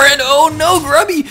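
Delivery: very high, loud alarmed cry; excited outcry. Real, not acted. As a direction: caster gasps at near kill. Void Ray nearly dies.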